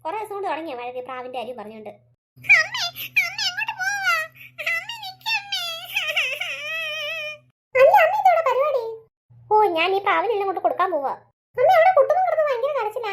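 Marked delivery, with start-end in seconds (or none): 2.4-8.8 put-on high, drawn-out child's call; a small boy wailing after his mother; little boy calling. Young son calling out as mother leaves, high drawn-out child voice. 11.6-13.1 put-on a small boy wailing after his mother; little boy calling. Child voice reporting to mother.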